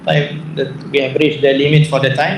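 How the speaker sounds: ordinary delivery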